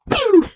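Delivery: very high zap noise; imitated pew. Movie fan retelling a transformation, high pew effect. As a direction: squeal out a little laser sound.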